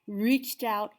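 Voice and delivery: ordinary delivery, nothing marked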